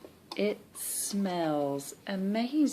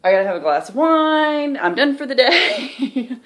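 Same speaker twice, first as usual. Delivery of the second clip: loud, high, laughing mock exasperation; a laughing, melodramatic "I'm done"; overwhelmed self; 6 semitones higher, 10 dB louder than usual. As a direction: throw up your hands, laughing. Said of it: "I would have been like".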